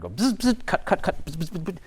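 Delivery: high buzzing imitation of a fast machine; hummingbird buzz. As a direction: buzz it out like a darting hummingbird, with whispered cuts. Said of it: "it was like a hummingbird".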